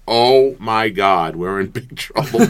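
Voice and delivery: loud, stretched-out retold awe; awestruck dread. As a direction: draw it out slowly and loud, overwhelmed. Retold awed reaction to a dunk.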